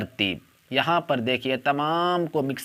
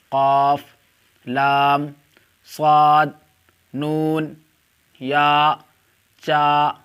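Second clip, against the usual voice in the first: each letter called out loud and separate; alphabet recitation; 5 dB louder, much slower than usual. Shown as a demonstration. Teacher calling out Urdu letters to online students, loud and deliberate. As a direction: drill the letters slowly for the class.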